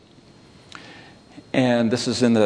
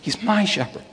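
softer line with a high "my"; stress on MY; 9 semitones higher, 4 dB quieter than usual. Shown as a demonstration. Preacher feeling cared for as he reads, stress on "my". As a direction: savour "my", then let "shepherd" fall. Repeats the emphasis on "my.

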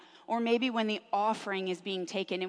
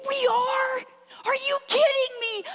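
put-on much higher, louder child's amazed squeal; excited kid; 14 semitones higher, 5 dB louder than usual. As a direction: squeal it like a thrilled little boy. Mom voicing her excited son.

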